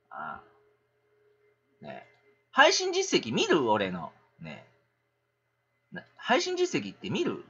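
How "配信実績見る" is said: ordinary talk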